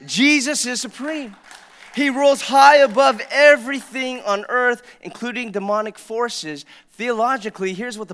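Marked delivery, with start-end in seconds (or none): 0.1-6.6 loud, slow declaration from the pulpit; loud proclamation. Real, not acted. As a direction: proclaim it to the whole room, slowly and loudly. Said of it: "I'm gonna say it out loud".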